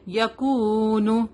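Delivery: یکون with the واو stretched very slowly; long vowel. Shown as a demonstration. Teacher shows the madd on the waw, very slow. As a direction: draw out the oo in یکون. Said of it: "واو لین ہے".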